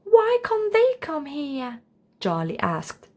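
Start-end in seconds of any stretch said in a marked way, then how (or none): 0.1-1.8 put-on high, slow little boy's plea; slow high childlike question; pleading child. A narrator's reading. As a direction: beg like a small child. Charlie pleading with parents.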